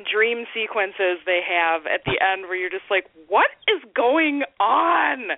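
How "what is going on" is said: bewildered. Retold or imitated. Bewildered viewer reaction.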